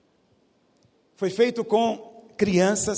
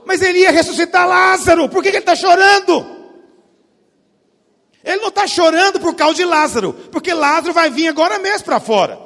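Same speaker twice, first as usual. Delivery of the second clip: much higher and louder preaching; impassioned preaching; 11 semitones higher, 11 dB louder than usual. Acted. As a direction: lift the hall with the question. Preaching climax.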